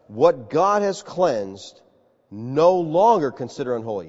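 very slow, weighty "What God has cleansed"; slow divine command. Acted. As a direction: lay each word down slowly like God. God's voice repeats command.

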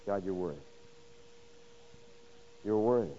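soft, low words of surrender to God; voicing a humbled worshipper, softly; humble worship. Job's humble worship voiced softly.

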